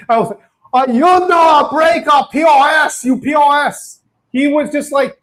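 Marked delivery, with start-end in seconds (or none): 0.7-4.0 hammed-up Chinese accent, shouted and raised. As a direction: bark it like an angry Chinese restaurant owner, in accent. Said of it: "Sorry for doing the Chinese accent".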